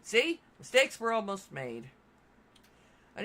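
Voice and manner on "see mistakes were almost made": excited. Real, not acted. Catching a near mistake.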